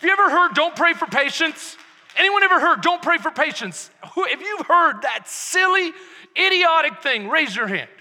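loud, rising sermon voice; preaching at full force. Acted. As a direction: preach it out with fire.

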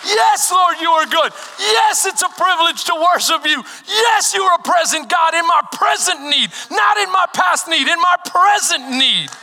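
loud, high, fervent praise shouted out; passionate shouted prayer. Shown as a demonstration. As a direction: pour all your passion into it, full voice. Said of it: "Come on, with passion".

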